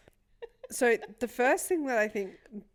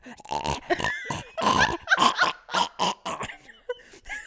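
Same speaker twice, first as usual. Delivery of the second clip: high loud choking squeal; strangled dog noise; wheezing bark imitation; 6 dB louder than usual. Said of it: "his bark's like".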